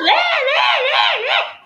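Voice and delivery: high wailing baby cry, mimicked; imitating a newborn's wail; baby crying imitation. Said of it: "ele vai nascer chorando".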